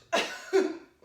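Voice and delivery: quick embarrassed laugh; short laugh. Real, not acted. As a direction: laugh it off lightly. Laughs after burping mid-class.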